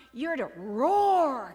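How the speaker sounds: loud, drawn-out roar on the last word; commanding lion. Acted. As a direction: order a roar by roaring it. Lion teaches roaring.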